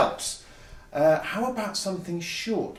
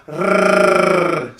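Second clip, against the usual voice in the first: long loud trilled rrrr; rolled R; 3 semitones higher, 8 dB louder than usual. Shown as a demonstration. Teacher showing children the horn trick, long rolled rrrr. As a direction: roll the tongue in a long rrrr. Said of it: "So I'm rolling my R's".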